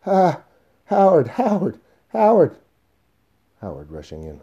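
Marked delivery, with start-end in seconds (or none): 0.0-2.6 high, panicked screams for help; terrified, full-voiced cries; frightened shouting. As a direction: yell out in sudden fright. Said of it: "leaping away with fright, shouting".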